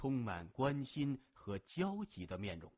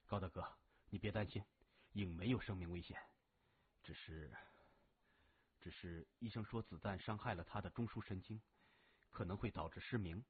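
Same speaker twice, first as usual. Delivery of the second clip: low, hushed, faltering reassurance; gentle, hesitant; 5 semitones lower, 9 dB quieter, faster than usual. A narrator's reading. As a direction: break bad news softly and reluctantly. Gently breaking bad news to patient. (低声迟疑地安慰)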